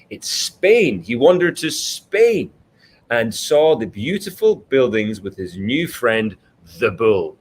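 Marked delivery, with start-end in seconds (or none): none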